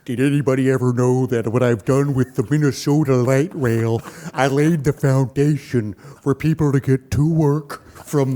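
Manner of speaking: deep Minnesota timbre; low put-on Minnesotan politician; Jesse Ventura. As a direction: drop the voice low and lecture like a conspiracy theorist. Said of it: "that deep Minnesota timbre".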